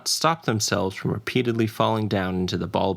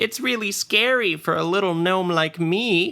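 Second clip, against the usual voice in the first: high little gnome voice, climbing higher to tasty; mock helpless gnome; 11 semitones higher, slower than usual. Gnome baits the undead with her helplessness, high and hammed-up. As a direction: ham up being a frightened, tasty snack. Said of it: "she exclaimed loudly".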